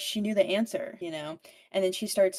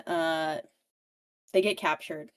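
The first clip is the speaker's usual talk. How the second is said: usual speaking voice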